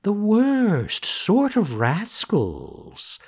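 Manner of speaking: high, very slow sly cat. Acted. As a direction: drawl it like a scheming cat. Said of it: "added the cat".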